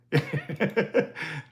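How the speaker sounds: short delighted laugh. Real, not acted. Thanking Mister Blair for the achievement, laughing. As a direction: laugh between thank-yous.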